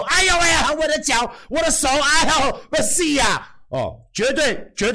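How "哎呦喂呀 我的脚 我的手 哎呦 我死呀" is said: high mock cries of a faked injury; hammed-up pain wailing. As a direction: overact getting hurt, wailing. Mock rolling on ground in pain.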